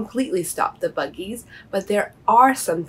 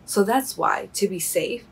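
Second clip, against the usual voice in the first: ordinary delivery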